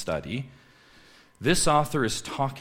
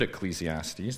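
ordinary talk, nothing marked

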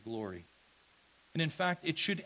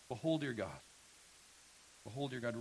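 lower, softening echo of a proclamation; hushed repetition; 4 semitones lower, 8 dB quieter, faster than usual. Real, not acted. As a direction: let it settle quieter each time. Repeats the proclamation, now hushed.

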